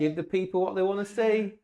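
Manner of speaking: a hyped-up ringside announcer goading his rival; playing it big. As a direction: ham it up for the crowd like a wrestling promo. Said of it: "You told me to play it big".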